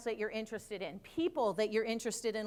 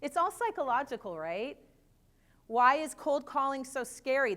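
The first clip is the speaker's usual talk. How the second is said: ordinary delivery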